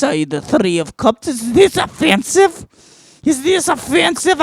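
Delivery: high put-on fortune-teller accent; bad Eastern European accent; mock Slavic accent. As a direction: read the tarot in a hammed-up Slavic voice. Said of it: "I'm not good with accents".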